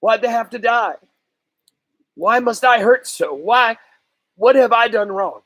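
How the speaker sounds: crying out to the heavens, voice catching; boy choking up over his dead dogs. Acted. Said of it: "In a choking voice".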